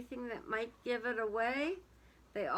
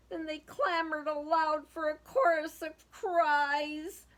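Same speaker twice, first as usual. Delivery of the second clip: lively storybook reading aloud; sing-song children's story voice; 6 semitones higher, 7 dB louder than usual. A narrator's reading. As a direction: read it like a rhyming picture book to kids. Reading a picture book aloud.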